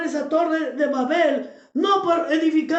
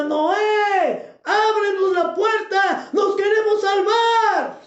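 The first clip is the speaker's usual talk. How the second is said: high, drawn-out cry of a drowning crowd; desperate shouted plea; 5 semitones higher, 4 dB louder, much slower than usual. Acted. Preacher acts out people begging Noah at the ark, desperate shout. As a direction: shout it slowly, begging to be let in. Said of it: "Y la gente le gritaba".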